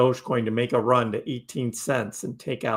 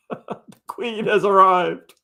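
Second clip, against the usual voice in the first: loud, high, drawn-out proclamation; mock royal announcement; 6 semitones higher, 6 dB louder, slower than usual. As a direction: herald a grand arrival in jest. Mock announcement of a viewer's arrival.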